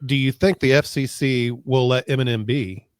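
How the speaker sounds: normal talking voice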